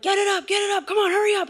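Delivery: mock dad yelling to hurry; dad shouting orders. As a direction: bark the orders like a frantic dad in the rain. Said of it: "my dad's like".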